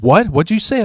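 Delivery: high-pitched mock bully's question; challenging demand. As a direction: imitate a bully demanding a repeat. Bully demands to hear the mutter.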